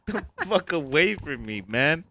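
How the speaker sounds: ordinary talk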